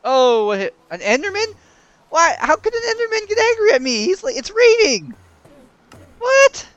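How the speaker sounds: very high, loud squealing disbelief; outraged surprise. Real, not acted. Gamer attacked by an Enderman, high outraged squeals. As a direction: shriek in indignant shock.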